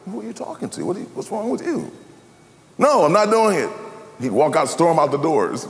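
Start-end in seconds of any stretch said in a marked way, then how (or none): 0.1-1.9 soft, higher-pitched acted question; imitating a bewildered onlooker, softly; puzzled questioning. Acting out puzzled question to father. 2.8-3.7 acted refusal. Acting out father's stubborn refusal.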